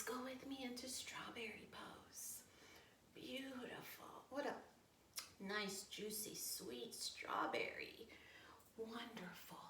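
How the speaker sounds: hushed slow delight; whisper. Acted. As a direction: whisper as if tasting something delicious. Savouring strawberries in hushed tones.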